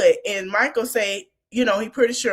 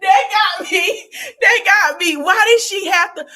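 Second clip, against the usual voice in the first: laughing hard; 10 semitones higher, 7 dB louder than usual. Real, not acted. Cracking up at the show.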